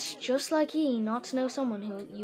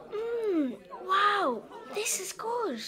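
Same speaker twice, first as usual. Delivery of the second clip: delighted tasting; 9 semitones higher, much slower than usual. Acted. Tasting delicious food.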